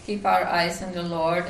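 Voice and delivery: ordinary talk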